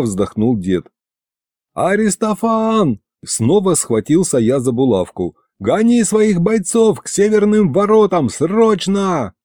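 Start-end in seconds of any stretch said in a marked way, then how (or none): 1.7-3.0 high, stretched call by name; urgent summons. Acted. Urgent call over pin communicator. 5.6-9.3 high-pitched, pressing command; urgent order. Acted.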